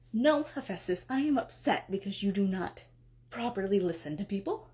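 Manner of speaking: normal talking voice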